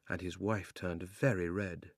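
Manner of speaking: usual speaking voice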